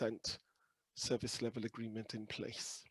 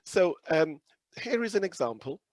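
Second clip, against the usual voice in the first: much louder, projecting hard; speaking up; 4 semitones higher, 11 dB louder than usual. Real, not acted. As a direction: push the voice out to be heard. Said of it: "Could you do a little bit more?".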